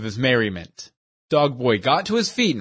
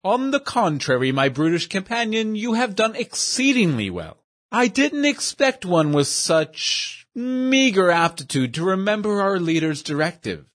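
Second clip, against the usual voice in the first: higher, fussy, overly formal voice; pompous gentleman; 5 semitones higher than usual. Acted. Portly schemer praises his dim companion, high and prissy. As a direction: play a prissy know-it-all praising his henchman.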